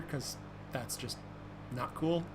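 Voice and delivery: normal talking voice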